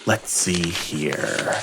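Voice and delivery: ordinary talk, nothing marked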